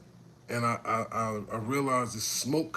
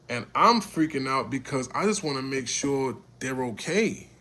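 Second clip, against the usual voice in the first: normal talking voice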